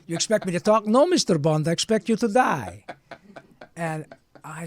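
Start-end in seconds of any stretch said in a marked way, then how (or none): 0.1-2.8 high, loud Bond villain quote; mock villain menace, fast then punched; Goldfinger impression. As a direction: deliver it like a gloating movie villain. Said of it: "you sound like Goldfinger".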